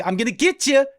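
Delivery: exaggerated "get" rhyming with "sit"; get said as git. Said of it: "where they pronounce get with an I".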